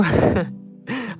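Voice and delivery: high burst of laughter; loud laugh. Real, not acted. Laughing at own answer.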